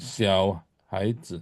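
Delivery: slow, soft XIAO HAIZI; model reading. Shown as a demonstration. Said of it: "Coba baca ini".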